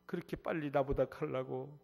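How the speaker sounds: a grieving father keening over his sick son; wailing father. Retold or imitated. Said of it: "울기만 울어요".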